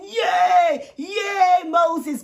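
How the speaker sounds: loud, high cheering crowd; hammed-up crowd cheer for Moses. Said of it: "The people are shouting".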